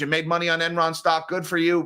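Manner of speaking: ordinary talk, nothing marked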